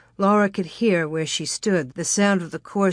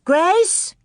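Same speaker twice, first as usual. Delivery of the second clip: high call across the yard; little girl calling for her sister; 11 semitones higher, 4 dB louder than usual. A narrator's reading.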